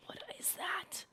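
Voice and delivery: high, drawn-out, soft disbelief; hushed alarm. Real, not acted. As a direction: breathe it out high and slowly in shock. First sight of strange creature.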